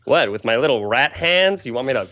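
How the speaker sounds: fast, high complaining rat; whiny rat voice. Acted. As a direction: squeak it out like an annoyed rat. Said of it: "Barrow goes".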